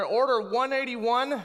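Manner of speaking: loud, high announcer call over a speaker; mock drive-thru intercom announcing an order. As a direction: bark out the order number like a fast-food intercom.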